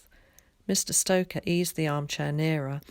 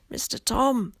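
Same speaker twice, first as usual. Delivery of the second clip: croaking; 8 semitones higher than usual. A narrator's reading. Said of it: "he croaked".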